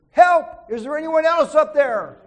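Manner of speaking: very loud, high panicked yell; screaming. Retold or imitated. Jack, refusing God's answer, screams for anyone else. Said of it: "Jack started screaming".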